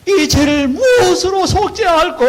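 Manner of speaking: slow, very high, loud keening; acted bitter wailing. Corinthian sinners asking how to atone, acted bitter wailing. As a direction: cry it out as a long hammed-up wail of repentance. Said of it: "막 구슬피 통곡을 하면서 울었다는 것입니다".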